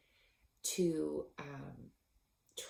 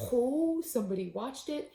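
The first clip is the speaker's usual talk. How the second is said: giddy; 7 semitones higher, 7 dB louder than usual. Retold or imitated.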